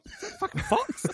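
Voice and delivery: high squeaky startled voice; mock startled yokel.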